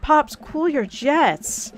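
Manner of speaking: slow drawn-out calming. Acted. Daughter calming dad, drawn out.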